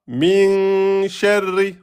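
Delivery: slow chanted "Min sharri" with a long held min; Quran recitation. Shown as a demonstration. Teacher chanting verse slowly for students. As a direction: intone it like tajwid recitation. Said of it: "vous avez quoi".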